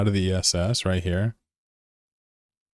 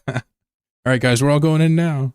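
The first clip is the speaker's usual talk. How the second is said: excited call; 7 semitones higher, 6 dB louder than usual. Real, not acted. Rallying the group to engage.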